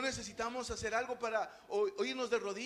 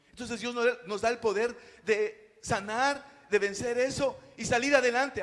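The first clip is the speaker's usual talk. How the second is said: fervent preaching; 7 dB louder than usual. Acted. Pastor urging the congregation that God heals, fervent preaching. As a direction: lift it up like a sermon climax.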